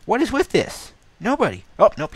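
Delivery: high, loud squeal of disbelief; real exasperated outburst. Real, not acted. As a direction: cry out loud, pitch shooting up. Startled by events while being hunted.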